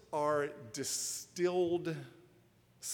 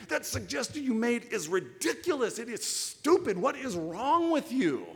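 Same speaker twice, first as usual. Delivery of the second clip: high, loud, scornful attack on a coworker; angry coworker; 9 semitones higher, 6 dB louder than usual. Acted. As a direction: berate a colleague in open anger. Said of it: "a raised voice".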